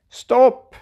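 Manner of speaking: sudden high, loud shout of stop; called out. A narrator's reading. Grandfather stopping the child in the kitchen, called out. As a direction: call out sharply to halt the child. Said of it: "ropar morfar".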